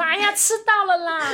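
high, loud, slow hammed-up cry with a laugh; mock yell. Teasing the storyteller about the fart, a laughing mock yell. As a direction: ham up a disgusted shout through laughter. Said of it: "你这时候没有大叫说".

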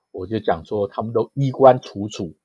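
usual speaking voice

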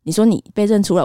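ordinary delivery

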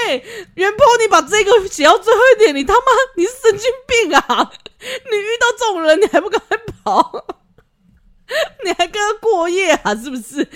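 high, loud, laughing scold; you're insane, laughing rant; laughing disbelief. Real, not acted. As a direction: shriek at her through laughter. Disbelieving rant at the letter writer.

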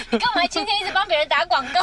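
loud laughing jab; laughing tease. Real, not acted. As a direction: tease him through laughter. Laughing teasing cohost.

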